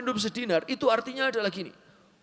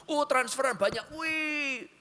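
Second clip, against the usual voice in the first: high, gleeful squeal kept soft; mock delight; 6 semitones higher, 4 dB quieter, slower than usual. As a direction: play someone thrilled by a bank transfer. Acts out joy at money arriving.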